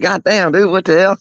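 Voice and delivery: high-pitched, incredulous exclamation; retold disbelief. Man to late-night visitor with cigarettes, incredulous. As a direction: blurt it in exaggerated, high disbelief. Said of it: "I'm like".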